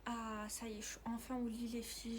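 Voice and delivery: hushed whisper so as not to wake anyone; bedtime whisper. Real, not acted. Whispering to her followers from bed at night. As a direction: whisper softly from under the covers.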